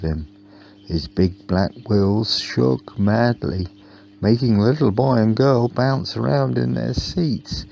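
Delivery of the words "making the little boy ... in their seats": ordinary delivery, nothing marked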